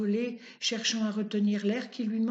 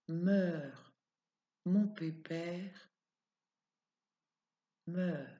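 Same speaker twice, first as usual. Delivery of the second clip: soft, slow and lower; tender farewell; 3 semitones lower, 6 dB quieter, slower than usual. Acted. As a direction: murmur gently to the dying animal. Man comforting dying donkey softly.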